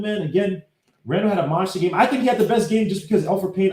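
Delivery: normal talking voice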